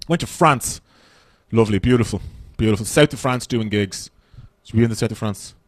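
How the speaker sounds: ordinary delivery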